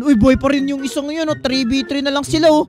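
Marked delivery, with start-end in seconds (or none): none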